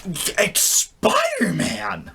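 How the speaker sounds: put-on high, slow, thrilled child's cry; excited kids. Kids at the hospital spotting their hero, high slow excitement. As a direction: squeal it like a kid spotting a hero. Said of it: "all the kids are like".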